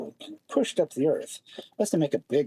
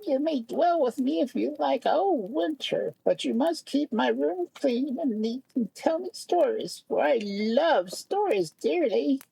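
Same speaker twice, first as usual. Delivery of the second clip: field mouse voice; 13 semitones higher than usual. A narrator's reading. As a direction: voice the kindly field mouse, pitched way up. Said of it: "she said a little later".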